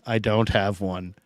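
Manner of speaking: quiet, trailing echo of the line; quiet repeat. Real, not acted.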